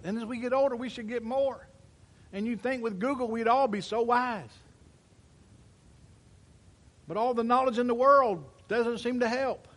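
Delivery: loud, high, driving sermon voice; full-force preaching. Acted. Pastor preaching hard to his congregation, loud and high. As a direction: preach it out to the whole room.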